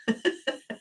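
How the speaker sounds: breathy burst of laughter; real, breathy giggle fit. Real, not acted. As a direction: crack up helplessly. Cracks up at own remark.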